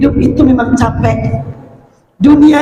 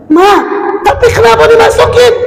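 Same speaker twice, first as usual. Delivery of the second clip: put-on high, fast child's question; whiny child; 9 semitones higher than usual. Child asking mother why, high and quick. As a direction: voice the worried kid.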